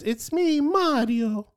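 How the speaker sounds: very high-pitched cartoon plumber voice; Mario. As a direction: squeak it out high like the game catchphrase. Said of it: "he does a voice".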